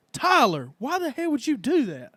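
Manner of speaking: high, loud mock scolding of his son; dad yelling at his kid in a high, loud put-on voice; mock accusing dad. Dad blames his son for the fart, high loud scolding. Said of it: "looks at me and says".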